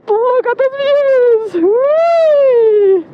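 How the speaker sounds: high, loud joyful whoop; thrilled whee. Real, not acted. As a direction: let out a gleeful whee. Thrill at the mountain views.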